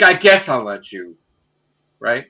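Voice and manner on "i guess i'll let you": loud mock-reluctant concession; retold grudging permission. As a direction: give in loudly, imitating grudging consent. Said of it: "He's like".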